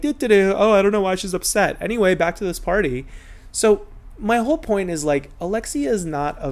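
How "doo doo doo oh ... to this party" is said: higher, louder, breezy humming mock; airy oblivious friend. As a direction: hum it off like nothing happened, carefree and clueless. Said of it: "she's just like".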